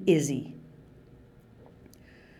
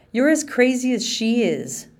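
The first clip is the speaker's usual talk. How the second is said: angry retort; 6 semitones higher, 4 dB louder than usual. Acted. Siblings quarrel over their father.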